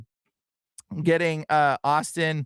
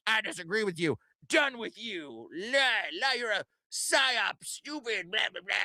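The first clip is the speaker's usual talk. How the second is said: mocking critic; 4 semitones higher, 5 dB quieter than usual. Mocking critics who dismiss him.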